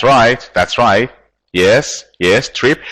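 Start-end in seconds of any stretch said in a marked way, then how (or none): none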